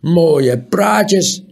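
loud, high sneering scoff. A narrator's reading. Scornful scoff. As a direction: owner sneers at Tiuri's fine words. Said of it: "smaalde de man".

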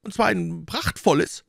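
high-pitched hopeful landlord's question; eager cry. A narrator's reading. Innkeeper hoping for a splendid horse, eager and high. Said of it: "rief der Wirt".

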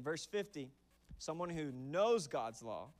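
normal talking voice

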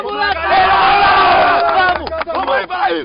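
long drawn-out loud yell to the squad; rallying shout. Real, not acted. Captain pumping up teammates before the game, a long loud rallying yell. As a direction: fire them up, stretching the shout. Rallying the team after prayer.

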